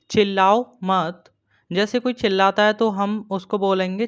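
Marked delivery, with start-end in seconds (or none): none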